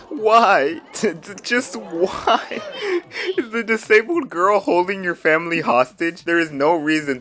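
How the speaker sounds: high, laughing why; cracking up in disbelief. Real, not acted. Disbelief at cruel comment.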